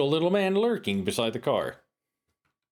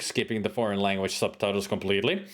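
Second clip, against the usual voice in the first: ordinary talk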